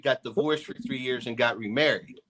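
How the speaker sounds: usual speaking voice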